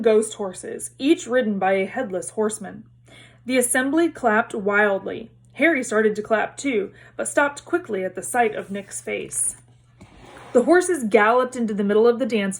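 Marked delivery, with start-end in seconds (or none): none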